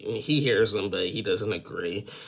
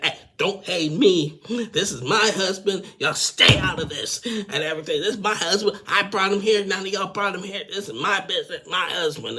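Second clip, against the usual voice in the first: mock furious rant, much higher and louder; imitated flip-out; 10 semitones higher, 3 dB louder than usual. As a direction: act out Angela snapping at the cast. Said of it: "Angela done flipped out".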